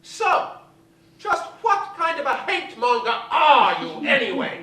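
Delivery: high, loud mocking diva attack; Betty Davis attacking. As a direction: tear into her with venom. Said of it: "lit into my mother with this torrent of abuse".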